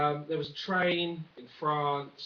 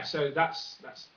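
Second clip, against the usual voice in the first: ordinary talk, nothing marked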